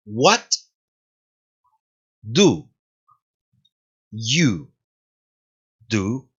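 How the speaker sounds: What, do, you, do? each said alone with long pauses; slow separated words of the question. Shown as a demonstration. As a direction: say "What do you do?" one word at a time. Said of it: "pronunciación".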